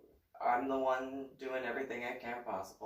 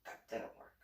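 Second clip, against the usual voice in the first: unvoiced, under the breath; whispered inner thought; 12 dB quieter than usual. Son's inner thought that the ploy failed, whispered.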